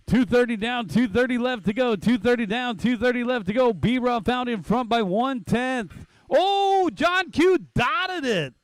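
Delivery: fast excited race call; play-by-play. Acted.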